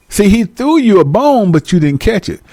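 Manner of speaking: ordinary talk, nothing marked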